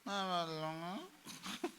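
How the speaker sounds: slow, soft, half-hearted mumble; mock a listless reply, dragging it out; weak mumble. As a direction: limp murmured promise. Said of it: "कैसे बोल रहे हो आप?".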